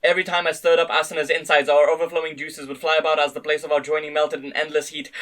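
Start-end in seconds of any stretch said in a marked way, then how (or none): none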